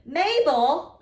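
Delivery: loud angry yell. Acted. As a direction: yell her name to stop her. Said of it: "I yelled".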